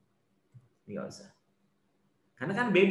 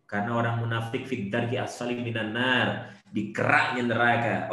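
ordinary delivery

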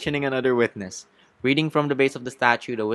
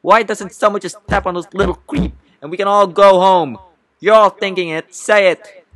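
shouted, high-pitched rant; rally the whole room in a fury; loud, worked-up courtroom tirade; upset outburst; 8 semitones higher, 10 dB louder than usual. Acted. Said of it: "Suddenly upset".

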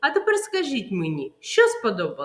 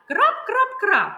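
quick, high, loud "Крап, крап, крап"; fast excited drips; 11 semitones higher, 6 dB louder than usual. Shown as a demonstration. Teacher showing fast rain to children. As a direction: rattle the raindrops out fast. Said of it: "Швидко, так?".

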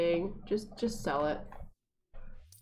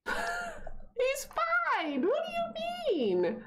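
much higher, squeaky protest; indignant kid voice; much slower than usual. Acted. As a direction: squeal it like an offended child. Voicing sim protesting staying home.

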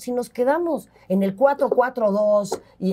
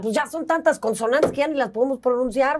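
ordinary talk

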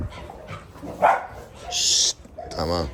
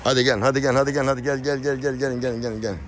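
higher, evenly repeated coaxing call; coaxing animals; 5 semitones higher than usual. Real, not acted. Owner urging animals to come, high repetitive coaxing. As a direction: chant it on one raised pitch to lure them.

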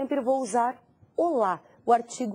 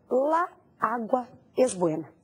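slow, each word set apart; careful teacher's model sentence, slowly; word-by-word demo. Shown as a demonstration. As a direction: space out "La agua es buena" with small gaps after "La" and "agua". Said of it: "Da maneira que eu falei pra você".

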